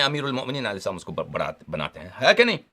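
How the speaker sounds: ordinary talk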